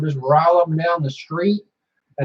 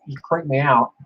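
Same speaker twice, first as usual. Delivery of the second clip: ordinary talk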